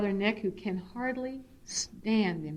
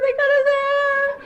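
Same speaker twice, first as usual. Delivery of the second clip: much higher, louder, slow wail; sobbing; 10 dB louder, slower than usual. Real, not acted. Mother sobbing to son Nick about the haircut, wailing. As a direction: wail it through tears. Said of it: "I'm still in tears".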